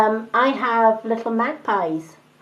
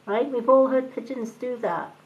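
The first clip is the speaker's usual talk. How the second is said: ordinary talk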